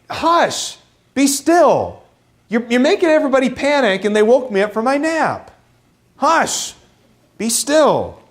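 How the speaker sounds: high, irritated shushing of a nuisance; annoyed. Acted. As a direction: shush them, woken from a nap and annoyed. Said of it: "it's more of an annoyance".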